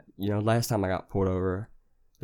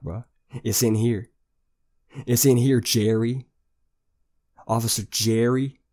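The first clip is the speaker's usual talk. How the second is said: louder, pleading and scolding the cop; loud exasperated yell; 4 dB louder than usual. Retold or imitated. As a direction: bark at Officer Jerry to calm down. Yelling at the cop Jerry.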